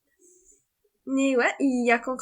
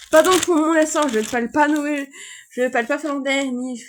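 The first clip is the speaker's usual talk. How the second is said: ordinary delivery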